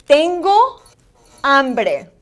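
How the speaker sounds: TENGO lifted at the end, HAMBRE falling, vowels full; teacher's clear "tengo, hambre", each vowel accentuated; accentuated Spanish vowels. Shown as a demonstration. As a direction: model "tengo hambre" word by word for learners. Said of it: "vowels are very important that you accentuate".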